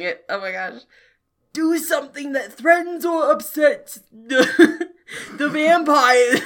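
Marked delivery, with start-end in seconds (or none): 1.5-6.4 cracking up mid-line; giggling while voicing; laughing through lines. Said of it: "I'm losing the accent".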